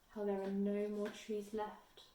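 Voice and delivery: ordinary delivery, nothing marked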